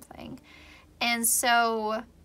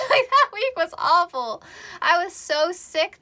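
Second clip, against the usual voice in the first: laughing exasperation; 6 semitones higher, 6 dB louder than usual. Real, not acted. Laughing recounting awful week.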